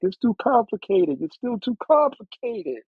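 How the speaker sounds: high, drawn-out whiny complaint; mock complaining friends. Friends complaining the chorus is too complicated, high and whiny. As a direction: whine it like a fussy friend. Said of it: "My friends are like".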